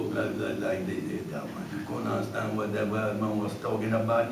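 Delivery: put-on deep mumbling Cajun old man. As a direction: growl it out like an old bayou man. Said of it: "that guy ended up being Leatherhead".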